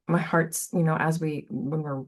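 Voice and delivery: ordinary delivery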